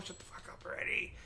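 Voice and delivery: hissed under the breath; annoyed whisper; whispered aside. Real, not acted. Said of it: "It's like, No".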